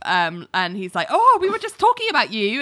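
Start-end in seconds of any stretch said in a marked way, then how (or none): 1.1-2.6 high, quick, falsely breezy; mock innocent cover-up. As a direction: cover up hurriedly in a much higher voice. Caught out, acting falsely breezy.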